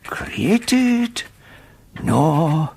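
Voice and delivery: slow, musing dictation fading low; dictating notes. Acted. Professor dictates his findings alone, slow and musing. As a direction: weigh each word as you write it.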